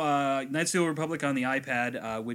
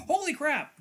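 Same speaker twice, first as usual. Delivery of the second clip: high, loud imitated astonishment; mock shock; 14 semitones higher, 5 dB louder than usual. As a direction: exclaim like a suddenly beaten opponent. Defeated game character's shock acted out.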